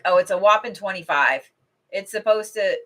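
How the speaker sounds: ordinary delivery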